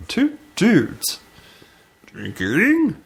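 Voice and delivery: strains for a rich booming voice and misses; Matt Berry impression. Host retries the Matt Berry voice on the show name. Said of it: "He's got a magic voice".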